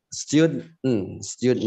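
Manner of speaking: "student" as "styud-n", no vowel before n; held-back ending. Shown as a demonstration. Teacher modelling for students, "student" with a held d. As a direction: hold the d in "student", then only n. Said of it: "Bacanya student".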